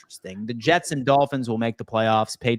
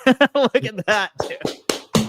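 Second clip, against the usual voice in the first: laughing; 11 semitones higher, 4 dB louder, much slower than usual. Real, not acted. Reacting to Rick and Morty cue.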